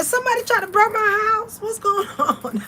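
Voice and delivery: incredulous squeal that dissolves into laughter; disbelieving. Retold or imitated. Asking who is trying to burn her house, high incredulous laugh. Retold disbelief about the fire.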